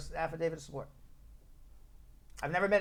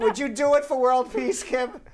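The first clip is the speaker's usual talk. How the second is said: lightly acted plea, loud and raised; mock skit plea; 10 semitones higher, 11 dB louder, slower than usual. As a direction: put a mock-grand question to Kim.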